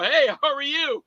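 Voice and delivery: imitated upbeat reply; retold friendly greeting. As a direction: answer a fan's greeting brightly.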